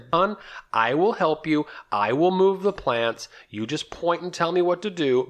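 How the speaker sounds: high, slow, emphatic promise; insistent retold reassurance. Husband retelling his offer to move plants, insistent. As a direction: spell it out with strained patience.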